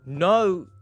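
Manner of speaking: ordinary talk, nothing marked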